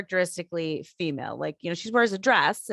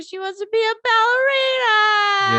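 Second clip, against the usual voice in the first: sad, near tears; 6 dB louder, slower than usual. Real, not acted. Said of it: "And that was sad too".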